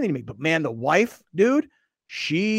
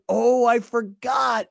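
mock sarcasm; 6 semitones higher, slower than usual. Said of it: "And I start laughing".

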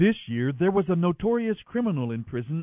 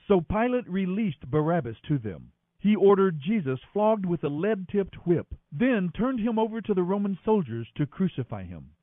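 plain reading voice

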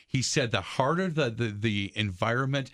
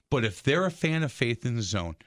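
ordinary talk, nothing marked

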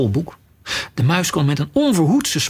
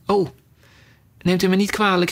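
quick apologetic cry; hasty apology; 4 semitones higher, faster than usual. A narrator's reading. Alice apologises again to the offended mouse. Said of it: "riep Alice weer".

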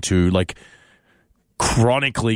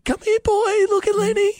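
very high, strained squeaky voice for the dog. Shown as a demonstration. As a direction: push the voice way up and crack it, cooing to the dog. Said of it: "You sound like a ghost".

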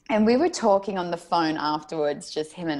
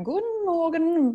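German greeting; 11 semitones higher than usual. Retold or imitated. Flight crew greeting in German.

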